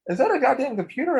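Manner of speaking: high-pitched incredulous question; mock astonished question; imitated disbelief. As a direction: act out an amazed visitor, voice shooting up. Imitating visitor's disbelief at office.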